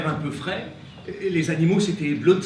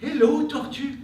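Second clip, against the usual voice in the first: cheerful greeting; 8 semitones higher, 4 dB louder than usual. Acted. Greeting the tortoise.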